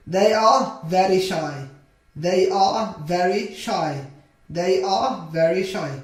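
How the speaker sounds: slow, evenly spaced words; slow modelling. Shown as a demonstration. Teacher models a sentence for beginners, slowly. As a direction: say it word by word for learners.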